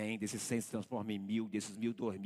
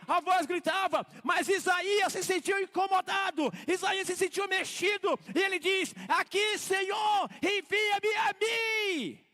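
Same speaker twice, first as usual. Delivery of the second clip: full-force preaching; 11 dB louder than usual. Acted. Pastor to congregation on servants, fervent full-force preaching. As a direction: preach it out, slowing on each last phrase.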